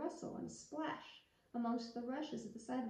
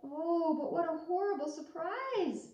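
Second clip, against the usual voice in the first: surprised; 5 semitones higher, 5 dB louder than usual. A narrator's reading. Narrator dramatizes the shock.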